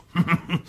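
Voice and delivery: a short pleased laugh at his own wit; chuckle, delighted with yourself; smug little laugh; self-satisfied chuckle. Acted. Earl pleased with his own text.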